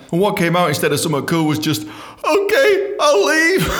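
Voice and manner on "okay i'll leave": high, loud, strangled whimper; squeaky meek surrender. Retold or imitated. Fired by the boss, answering in a high strangled whimper. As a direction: cave in like a frightened kid.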